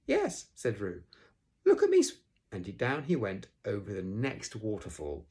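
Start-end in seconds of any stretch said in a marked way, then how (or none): 0.0-0.5 very high, loud little yes; Roo's squeak. A narrator's reading. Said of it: "squeaked Roo". 1.7-2.2 very high, very loud squeal, cut off; Roo squeaking. A narrator's reading. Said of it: "squeaked Roo".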